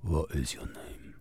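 whispered menacing question; hushed secret agent interrogation; whispered spy interrogator. Pretend. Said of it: "I'm trying to do a different voice".

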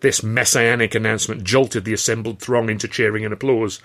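ordinary delivery, nothing marked

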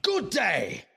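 high, loud, frantic mock flattery; exasperated rant. Bond shouts absurd praise at his boss, desperate to leave. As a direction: shout the praise to escape the room.

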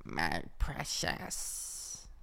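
very slow, hushed whispery creature; soft, whispered creature croak; Gollum. Acted. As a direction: draw it out in a low rasping whisper. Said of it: "what does Gollum sound like".

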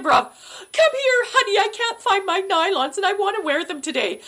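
high-pitched, hammed-up fussing wife; improvised stage wife. Actress covering husband's forgotten lines, high improvised fussing. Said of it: "here comes the improv".

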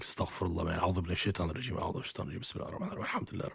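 hushed, hurried recitation in fear; panicked muttered prayer. Retold or imitated. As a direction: mutter protection prayers under your breath. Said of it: "all of us just like".

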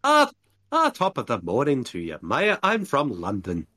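put-on London accent that comes out Irish; badly faked London, lilting into Irish; botched London accent. Showing friends a London accent, badly, sounding Irish. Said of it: "that sounds more like Irish".

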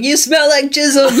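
high, slow, drawn-out yell; Robert Pattinson yelling. Acted. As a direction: holler it in mock outrage, stretching the words. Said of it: "we can use Robert Pattinson yelling".

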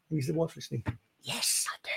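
hushed secret aside; whisper. Pretend. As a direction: whisper it so the wife won't hear. Joking the wife may hear.

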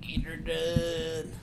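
slow mock country drawl; drawled catchphrase. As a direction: drag it out like the Cable Guy. Echoing the catchphrase.

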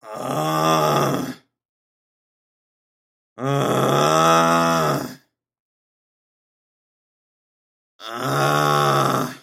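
loud rattling snore, rising then dropping deep; imitated snoring. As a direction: saw out a loud snore through the nose. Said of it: "sounding like this".

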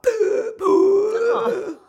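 loud high gasping intake of breath; big sobbing gasp. Retold or imitated. Mum mimicking her son's upset gasp. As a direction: gasp like a child too upset to talk. Son too upset to speak.

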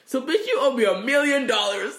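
loud, high, sassy demand; hammed-up shout for the money; mock demand. Demanding payment from ex-spouse.

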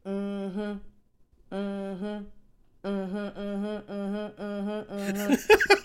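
rhythmic hummed mm-hmms, swaying; bobbing in the ocean. Retold or imitated. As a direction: hum along like a woman floating in the sea.

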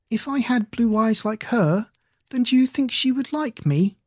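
high, small voice near tears; lightly voiced crying girl; tearful little girl. Tearful child asks aunt.

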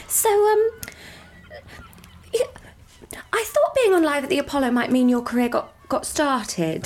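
a careful, disappointed mum, with long hesitations; mum's hesitant probing. Retold or imitated. Daughter voicing her supportive mum asking about her career, hesitant. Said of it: "She went like".

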